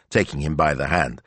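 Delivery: plain reading voice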